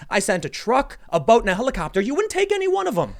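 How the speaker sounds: rising high and loud to the punchline; God losing patience, climbing up; exasperated God. Retold or imitated. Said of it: "And he goes".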